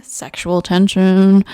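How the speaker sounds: loud, slow, drawn-out relish; playful emphasis. Real, not acted. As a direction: stretch both words out teasingly. Playfully reads out the card's phrase.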